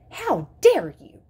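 loud, slow, outraged exclamation; shocked gasp. A narrator's reading. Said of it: "the teacher gasped".

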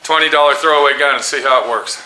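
ordinary talk

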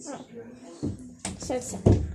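breathy little whispered aside. Real, not acted. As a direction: say it under your breath. Muttered aside.

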